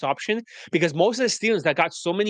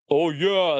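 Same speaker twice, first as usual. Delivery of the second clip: mocking the rival coaches to listeners, lightly put-on drawl; mock agreement in a put-on voice. Coach parodies what rivals say, mocking. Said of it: "as they say".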